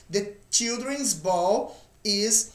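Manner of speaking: slow, careful English model sentence; slow model reading. Shown as a demonstration. Teacher models linked pronunciation slowly for students. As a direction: read it slowly, joining children's to ball. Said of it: "E a pronúncia".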